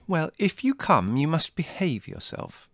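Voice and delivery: high, slow veiled warning; hint at a threat; enigmatic. A narrator's reading. Margot warning Jerry off the walk, enigmatic. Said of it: "she said enigmatically".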